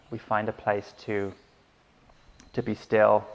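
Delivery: unhurried meditation guidance with long pauses; guided meditation. Acted. Teacher settling listeners into breath and body, calm guided meditation. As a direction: guide them gently into stillness, leaving space between phrases.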